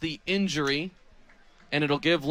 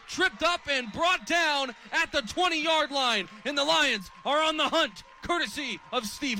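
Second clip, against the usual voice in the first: climbing ever higher as the runner breaks free; excited long run call; 9 semitones higher than usual. Real, not acted. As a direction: ride the run upfield, pitch soaring. Long run called live.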